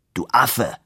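ordinary delivery

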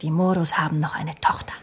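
hushed, conspiratorial whisper; breathless whisper to her boss; whispered secret. Acted. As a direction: lean in and whisper the news. Secretary reveals hidden information.

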